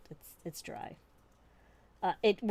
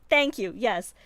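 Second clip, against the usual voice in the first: put-on plucky British orphan accent; chirpy little British orphan voice; 5 semitones higher, 8 dB louder, slower than usual. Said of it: "extra British orphan voice".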